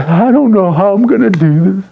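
whiny, put-on girl complaining; mock helpless complaint; exasperated girlfriend. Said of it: "a lot of girls are saying".

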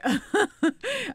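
embarrassed laugh. Real, not acted. Embarrassed about being scared.

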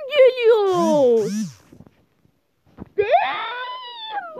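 loud, high cry of frustration; frustrated outburst. Real, not acted. Gamer reacting to a bad moment, crying out. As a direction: let the annoyance burst out.

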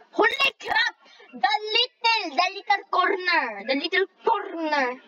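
high crying holy crap; anguished squeal. Real, not acted. Gamer falling at the corner, high anguished squeal. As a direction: wail at the corner.

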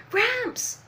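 high acted cry for someone; alarmed high shout in character; urgent calling out. Voices Luffy calling out to Hyogoro.

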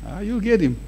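normal talking voice

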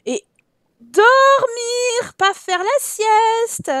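high, loud, very slow scolding; exasperated insistence. Real, not acted. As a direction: stretch each word out, fed up. Scolding Sims kids about bedtime.

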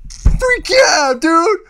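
high, loud whoop of joy; excited yell. Real, not acted. Fan yelling to viewers, thrilled and loud. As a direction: shout it out thrilled. Excited outburst about the scene.

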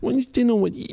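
put-on cutesy baby talk; playful toddler voice. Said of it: "doing a baby voice in public".

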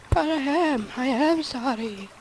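high, drawn-out put-on plea; mock pleading. Player insisting to self they are sorry, mock pleading. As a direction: protest slowly with hammed-up remorse.

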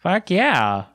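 put-on high Valley Girl gush; ditzy high-pitched cheer; snake voice. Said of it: "is that your new snake voice".

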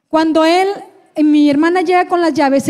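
usual speaking voice

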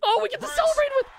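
high, squealing fan voice; mock excited. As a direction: gush like a giddy fan. Celebrating Bon Jovi coming on.

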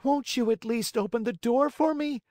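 beseeching. Acted. Pinocchio begs the maiden to open the door, high pleading. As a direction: beg to be let in. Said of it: "in a beseeching voice".

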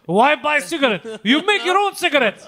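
high, hammed-up foreign villager voice; Borat. Riffing on rolled cigarettes in Europe, Borat voice. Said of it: "looks exactly like Borat".

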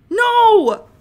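sudden loud, high "No!"; shocked cry. Real, not acted. Streamer yelps at an unwanted hire, loud and high. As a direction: yelps as the game goes wrong. Game hires someone by mistake.

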